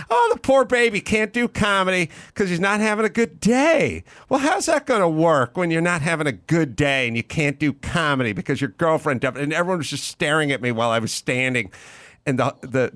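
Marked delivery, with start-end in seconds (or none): 0.1-9.4 mocking fake pity in a high, imitated yell; Cynthia Seghetti. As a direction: publicly ridicule the student as a blustery teacher, easing off at the end. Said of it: "her trademark, was yelling".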